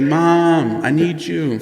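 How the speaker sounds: hammed-up robot calling for his mother; mock cry for mom. Dying Ultron cries out for his mom, mock whiny. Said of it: "he cries out for his mom".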